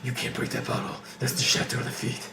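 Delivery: hushed unvoiced whisper. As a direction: breathe it out urgently. Voicing Robert protecting wine.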